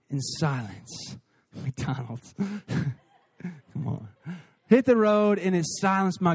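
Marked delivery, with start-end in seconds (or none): 0.1-4.1 much lower and softer, laughing under breath; hushed laugh. Real, not acted. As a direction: sink into a soft chuckle. Hushed laughing about McDonald's.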